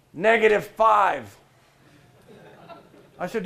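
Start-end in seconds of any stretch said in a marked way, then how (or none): 0.1-1.4 slow, loud, dragged-out sneer; a hostile worker answering the speaker, slow defiant drawl; sullen defiance. Retold or imitated. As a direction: spit it out slowly to provoke. Hostile worker gives his rating.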